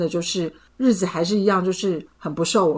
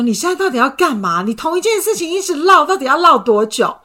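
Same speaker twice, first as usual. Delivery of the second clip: exasperated retort; 8 semitones higher, 5 dB louder than usual. Retold or imitated. Boyfriend's exasperated reply.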